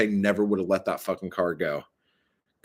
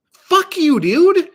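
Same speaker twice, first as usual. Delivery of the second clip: much higher, much louder curse; retold furious yell; 10 dB louder, much slower than usual. Telling chat how she yelled at the Honda staff, furious yell. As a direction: blow up at them, yelling.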